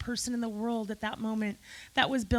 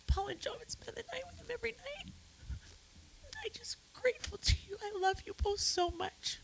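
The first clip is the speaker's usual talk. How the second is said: high, thin, whispery crying voice; tearful gratitude; 11 semitones higher, 7 dB quieter than usual. Real, not acted. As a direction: push the words out through tears. Tearful gratitude to friends at eulogy.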